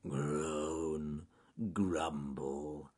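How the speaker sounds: low, quiet bear groaning; grumpy bear grumbling to himself; muttered grumble. A narrator's reading. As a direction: draw the words out as a sulky mutter. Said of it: "muttered Charles Growly Bear".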